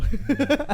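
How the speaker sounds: sudden high, loud shriek of laughter; shrill laugh. Real, not acted. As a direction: burst into a piercing squeal-laugh. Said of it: "don't make that noise".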